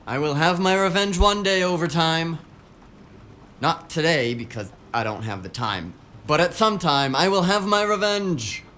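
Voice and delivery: tired worker hamming up a villain vow against overtime; hammed-up revenge vow. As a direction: swear vengeance on overtime, all theatre. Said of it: "And people say I'm the dramatic one".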